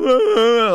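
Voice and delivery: loud, high mock moan; imitated moaning. Boxer teasing his crying friend in the van, mock moaning. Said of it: "Like, moaning".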